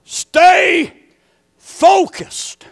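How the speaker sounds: high, loud and separated; emphatic repeat. Real, not acted. Preacher repeating his point to the congregation, loud and high. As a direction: hammer home each word apart.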